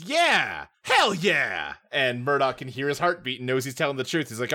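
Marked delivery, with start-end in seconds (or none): 0.0-1.7 mock-emphatic cheer, pitch swooping up then falling. Client asked if he's innocent, hammed-up emphatic yes. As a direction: imitate an over-eager client, drawn out. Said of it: "and he says".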